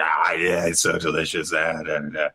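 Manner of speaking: put-on old giants enjoying. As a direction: savour it deep and pleased. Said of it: "They're both going".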